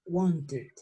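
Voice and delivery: "wanted" with a light "tid" ending. Shown as a demonstration. Teacher repeats the model, "wanted" ending in "id". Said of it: "I D".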